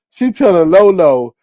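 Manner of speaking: ordinary talk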